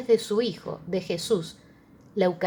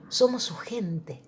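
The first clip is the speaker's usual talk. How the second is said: whispered; slower than usual. Real, not acted. Sermon, intimate repeated phrase.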